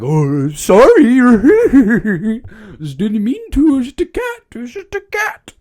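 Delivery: high, goofy cartoon dog voice; Scooby apology. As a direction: apologize in a squeaky giggling dog voice. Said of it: "Scooby's like".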